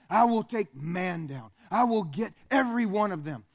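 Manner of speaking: the devil vowing to rule creation; Satan's vow. Acted. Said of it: "Satan was the first one to say".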